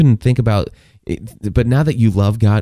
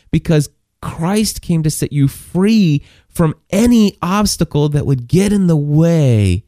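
higher, pleading voice of frustrated Paul; 5 semitones higher than usual. Retold or imitated. Paul frustrated with the Galatians, pleading higher. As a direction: plead with them like an exasperated apostle. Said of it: "Paul's frustrated. He's like".

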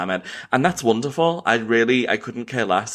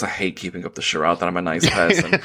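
ordinary delivery

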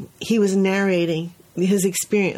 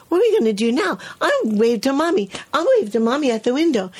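put-on higher, bright toddler voice, all better; cheerful little boy; 6 semitones higher, faster than usual. Teacher voicing the boy recovered after his cry. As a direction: chirp it like a kid who's fine now. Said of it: "he said".

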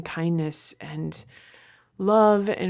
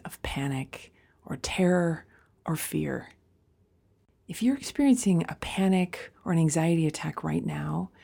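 guided meditation; 4 dB quieter than usual. Acted. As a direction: guide a panicked listener gently into calm.

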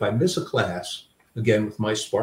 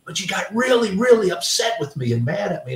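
put-on higher, childlike complaining; grandson's voice; 10 semitones higher than usual. Grandson telling grandpa about mom, in a high, childlike complaining voice. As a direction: tattle like a hurt little boy. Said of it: "He goes".

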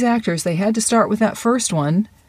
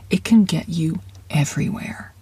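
breathy whisper, no voice at all; whispered promise to the listener. Acted. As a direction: let it land as a hushed secret. Coach repeats the line, whispered first.

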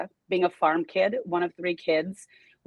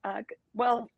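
nervous; 5 semitones higher than usual. Real, not acted. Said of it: "it's because I am nervous".

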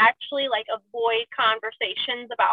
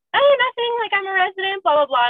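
breezy brush-off; 8 semitones higher, 4 dB louder than usual. Retold or imitated. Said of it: "And I'm like".

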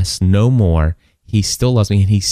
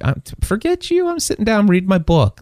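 defiant retort; 11 semitones higher than usual. Retold or imitated. Retelling a refusal to stand in church, mock defiant. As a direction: imitate brushing someone off in a high voice.

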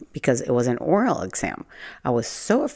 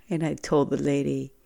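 ordinary delivery

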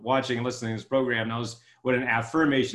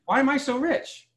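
1 excited question; 10 semitones higher than usual. Retold or imitated. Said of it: "Wow, that's a really cool question".

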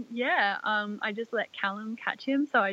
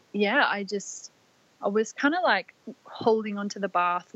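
ordinary talk, nothing marked

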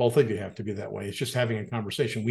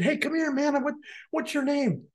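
high mock friendly call. Calling the worker over.

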